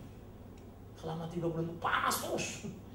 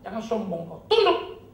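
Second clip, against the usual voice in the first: stern command; 4 semitones higher, 7 dB louder, slower than usual. Retold or imitated. Preacher scolding his own soul, stern rising command. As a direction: scold your own soul, ending much higher and louder. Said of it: "Jadi bilang sama dirimu".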